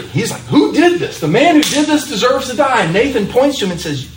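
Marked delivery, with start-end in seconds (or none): 0.4-2.8 high, outraged demand from the wronged king; angry king. Retold or imitated. David demands who stole the lamb, outraged. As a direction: let the king's indignation flare up high. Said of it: "David's angry".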